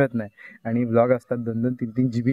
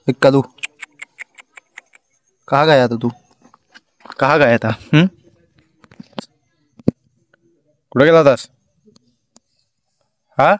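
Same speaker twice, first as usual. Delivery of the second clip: raised calling voice, short questions; calling the dog; 5 dB louder than usual. Real, not acted. Asking his dog Kalu where he went, raised calls. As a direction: call the dog over and ask where he went.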